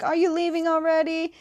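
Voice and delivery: high-pitched imitation of pupils; dismayed students. Students to Toph as she flies away, mock dismay. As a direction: put on a high little pupil voice. Students voiced as teacher leaves.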